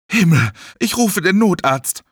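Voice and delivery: alarmed. Acted. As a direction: react with urgent shock. Mel entdeckt den Notfall. (aufgeregt und erschrocken)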